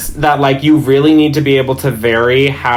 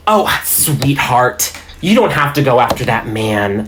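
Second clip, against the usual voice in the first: soccer mom. Shown as a demonstration. Player voicing Jen to a friend, played-up soccer mom. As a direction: play up a soccer mom consoling someone. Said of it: "a soccer mom".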